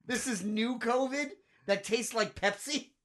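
slow, high incredulous mock. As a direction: drawl it in disbelief. Mocking new covid.

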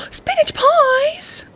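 high, slow delighted gasp; excited gasp. Real, not acted.

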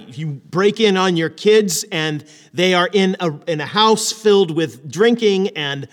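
usual speaking voice

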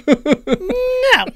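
very high cartoon squeal; squeaky cartoon creature. Friends riffing on Toad's voice, very high squeak. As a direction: squeak it out as a tiny game character.

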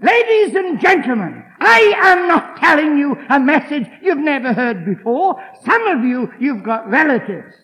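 loud, high fervent sermon; preaching at full force. Acted. Preacher exhorts the congregation, loud and fervent. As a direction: drive the message home.

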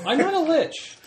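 squeaky voice, way up high; high indignant protest. Acted. Indignant in-character retort.

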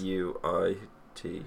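B E R U I T spelled letter by letter, soft; spelling it out. Shown as a demonstration. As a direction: read B E R U I T as single letters, quietly. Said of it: "Can you use it in a sentence?".

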